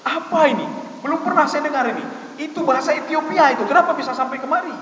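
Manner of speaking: Umar astonished. Retold or imitated. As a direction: Umar shaken by the verse. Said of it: "bergetar hatinya".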